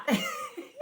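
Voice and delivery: sudden high squeaky giggle; short, high laughing squeal. Real, not acted. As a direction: burst into a helpless little laugh. Laughing at her own overwhelm.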